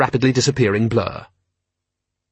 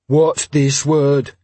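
very slow troll question; puzzled troll; 3 semitones higher, much slower than usual. Acted. Troll asking constable about a word, slow and ponderous. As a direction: struggle over reading, slowly.

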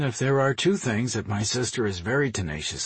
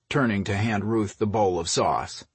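ordinary delivery, nothing marked

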